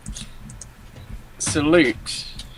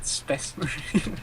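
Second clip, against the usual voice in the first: much softer slow echo in a put-on voice; breathy echo; 10 dB quieter, slower than usual. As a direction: murmur it back slowly. Echoing the quote.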